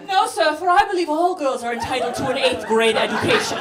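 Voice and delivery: hammed-up speech for a cause; Malala. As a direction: declare it like an impassioned young activist orator. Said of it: "Just full Malala".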